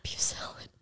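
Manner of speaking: conspiratorial whisper trailing off. Acted. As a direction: hush it like a secret. Mock whisper of the punchline.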